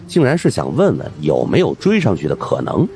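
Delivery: usual speaking voice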